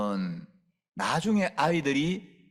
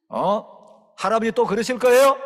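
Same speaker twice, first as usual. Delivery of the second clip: much louder, higher demand; mock stern threat; 8 semitones higher, 10 dB louder than usual. Preacher beating the floor with a belt while scolding grandpa, loud.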